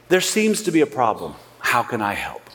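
calm helpful tone. Shown as a demonstration. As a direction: ease off and sound ready to serve. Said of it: "The tone changes everything".

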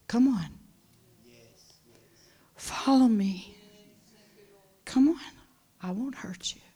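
soft, whispered coaxing; gentle whisper. Acted. Shepherd coaxing a stubborn little sheep, in a whisper. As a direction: coax it tenderly, barely voiced.

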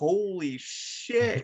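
slow, drawn-out stunned whisper of awe; a slow stunned aside to the audience; stunned holy shit. Retold or imitated. Retelling shocked reaction at awards.

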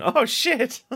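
high, loud gasped-out exclamation; amazed outburst. Retold or imitated. Podcaster recalling the Spider-Man reveal, amazed outburst. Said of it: "it was literally just an".

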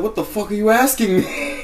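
high-pitched, baffled protest; incredulous high reply. Acted. New Yorker answering an absurd question, incredulous. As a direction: send the question up high in disbelief.

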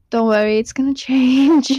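laughing through the words; laughing promise. Real, not acted. Teen reassuring podcast listeners, laughing as she promises. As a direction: giggle as you reassure them.

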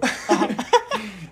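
high laugh; real amused laugh; laughter. Real, not acted. Laughing at the burned eraser joke.

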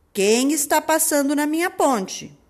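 loud challenging wolf. Acted. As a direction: bellow it as the wolf. Wolf challenges second goat. (voz de lobo)

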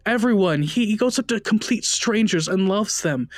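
high, pleading and near tears; a frantic woman begging for her dog; distraught young widow. Acted. Hattie begging Jack about her dog, high and distraught.

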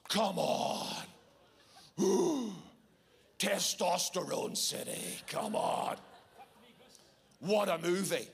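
macho hype with a loud whoop; slow, punchy, hammed-up excitement; pumped-up enthusiasm. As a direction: whoop it up like an action-film fan. Hyped-up reaction to Top Gun.

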